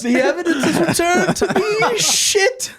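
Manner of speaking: high-pitched, helpless laughing panic; laughing mock dismay. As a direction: squeal it through laughter as doom closes in. Retold panic, cracking up laughing.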